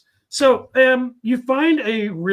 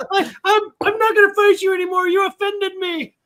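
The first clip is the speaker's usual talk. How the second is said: high, loud pretend outrage; hammed-up hurt feelings; mock offended; 9 semitones higher, 6 dB louder than usual. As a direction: play the wounded party, pitched way up. Mock hurt after a joke.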